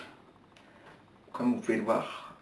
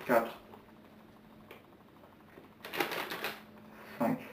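counting through a mouthful of marshmallows; words muffled by stuffed cheeks; mouth full; 2 semitones higher, 4 dB quieter, much slower than usual. Real, not acted. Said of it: "treize chamallows dans la bouche".

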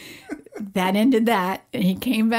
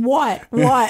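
louder playful mimic of the boy; mock toddler voice; 3 semitones higher, 5 dB louder than usual. Mom retells son working her lips, playful child voice. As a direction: act the child making mom's lips speak.

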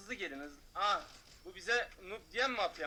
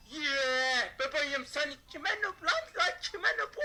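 high, loud jeering taunt with a laugh; mocking taunt; 7 semitones higher, 5 dB louder, slower than usual. Real, not acted. Gamer taunting the player who called him noob, loud jeer. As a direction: throw it back in their face, jeering.

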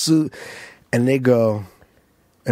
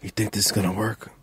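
softer, lower, worried aside before going on stage; nervous hushed question; 6 semitones lower, 10 dB quieter than usual. Retold or imitated. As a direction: ask it quietly, half hoping for reassurance. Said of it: "I go".

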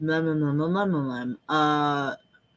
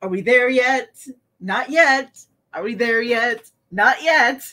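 lightly voiced kid and parent trading lines; sing-song car-trip nagging; a hint of bored back-seat whining; whiny kid in the car; 4 semitones higher, 5 dB louder than usual. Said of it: "You know what that reminds me of?".

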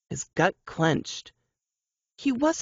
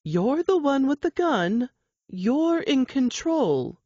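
higher, slow, gentle reassurance; calm soothing voice; 7 semitones higher than usual. Acted. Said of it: "Her calm, soothing voice continued".

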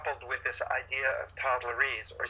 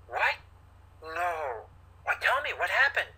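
mirror a third of the upset, not more; engaged, caring questions with some lift; a little of their emotion; 4 semitones higher than usual. Shown as a demonstration. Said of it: "I'm having a little bit of your emotion".

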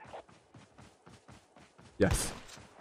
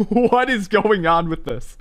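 high, slow, laughing exclamation; laughing disbelief; 7 semitones higher, 4 dB louder than usual. Real, not acted. Streamer baffled by the weapon, laughing disbelief. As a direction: draw it out through real laughter.

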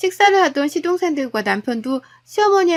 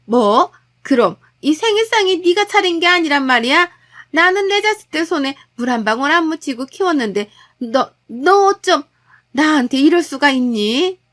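shocked outburst, raised and louder in places; aggrieved mother-in-law; 3 semitones higher, 4 dB louder than usual. Acted. Mother-in-law learning her son cooked the meal, indignant. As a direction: protest like a betrayed mother.

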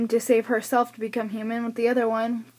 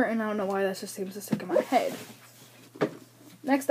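normal talking voice

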